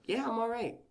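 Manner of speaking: a casual "I'm fine" squeaked up high; high-pitched brush-off. Retold or imitated. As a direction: brush it off lightly, voice jumping high. Retold reply while shaking.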